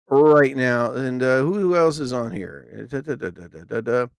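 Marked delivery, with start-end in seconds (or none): none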